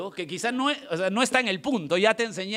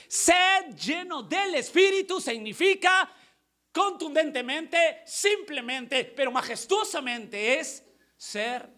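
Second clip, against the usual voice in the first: slow, raised proclamation to the congregation; emphatic preaching; 6 semitones higher, slower than usual. Real, not acted. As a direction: proclaim the key point word by word. Preaches the key point.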